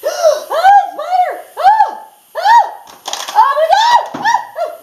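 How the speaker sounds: shrieking high and loud in fright; startled screams at a spider; real spider scare. Real, not acted. Teacher spots a spider in the test booklets, screaming.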